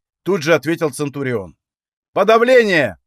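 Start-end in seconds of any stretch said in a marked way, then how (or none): 2.1-3.0 shouted spell. A narrator's reading. Witch casts suppression.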